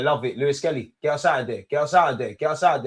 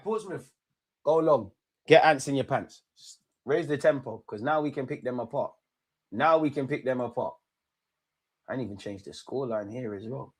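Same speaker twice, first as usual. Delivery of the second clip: ordinary talk, nothing marked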